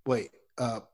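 ordinary talk, nothing marked

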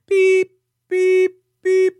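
high, loud, steady beeps; reversing-bus beep. Acted. Host mocking James Biden backing the bus over someone, shrill reversing beeps. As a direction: imitate a backing-up alarm with level beeps. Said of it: "That's the sound of the bus backing up".

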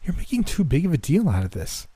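high-pitched, sulky protest; petulant. A narrator's reading. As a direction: whine back defensively like a petulant child. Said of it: "frowning up at me like a petulant child".

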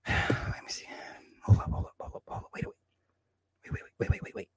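hushed whispered stalling to himself; whispering. Real, not acted. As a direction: whisper it as you check, not for the audience. Whispering while checking something.

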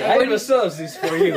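badly faked French detective accent; Poirot. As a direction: proclaim it in clumsy French accent. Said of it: "That's gonna be Poirot".

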